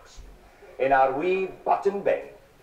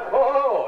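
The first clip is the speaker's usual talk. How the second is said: high, loud mock "Oh, ho, ho"; hammed-up hooting cry; 7 semitones higher, 4 dB louder than usual. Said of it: "you cry".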